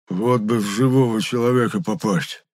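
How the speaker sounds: wistful, slightly put-on groan; weary character moan, lightly acted. A narrator's reading. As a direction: moan it with longing. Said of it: "простонал Епифан".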